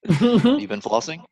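slow, high mock dentist question. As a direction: play the nosy dentist. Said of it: "it's like the dentist's office".

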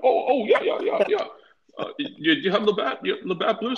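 put-on high, eager Canadian accent; squeaky Canadian voice, eager. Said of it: "this C-, Canadian accent came out of him".

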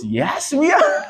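high, drawn-out yes breaking into laughter; gleeful proud retort. Acted. Preacher answering street hecklers' "weirdos", gleefully defiant.

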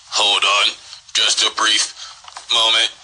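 slow, loud menacing broadcast; villain announcement. Acted. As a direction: announce the takeover slowly, coldly.

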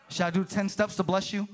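ordinary delivery

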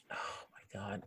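whispered, drawn back in bafflement; hushed disbelief. Acted. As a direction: whisper it under your breath. Mock awe at the weird game.

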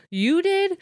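high, incredulous question; disbelief. Acted. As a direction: pitch it way up, acting stunned. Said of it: "like, disbelief".

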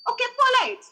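mock the polite brush-off, high and loud. Mocking polite tone.

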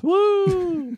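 high whooping cheer; excited cheer. Real, not acted. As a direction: whoop it up for Halloween. Cheering happy Halloween.

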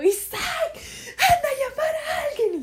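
very high, drawn-out wailing call; mock-hysterical high shriek, slow and loud; retold scream. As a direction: re-enact a desperate squealed cry for help. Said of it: "Y yo gritaba".

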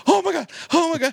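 very high, loud panicky squeal; panicked. Retold or imitated. Hunter panicking as the doe just lies there, high squeal. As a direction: lose it completely while fumbling to reload. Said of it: "and now I'm going".

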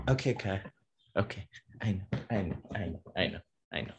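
zacht sussen tegen de hond; calm the dog down softly; soft soothing. Real, not acted.

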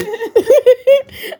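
high, loud giggling burst; laughing. Real, not acted. Telling friends about show and tell, laughing. Laughing at her own story.

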